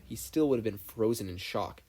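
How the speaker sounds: plain reading voice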